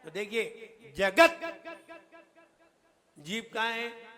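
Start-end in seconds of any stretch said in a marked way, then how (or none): 1.0-1.6 "जगत" loud and high, stopped hard on the t; half-consonant demo. Shown as a demonstration. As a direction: end "जगत" on a bare t, no vowel. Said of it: "ता कैसा है?".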